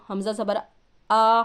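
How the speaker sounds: ordinary talk, nothing marked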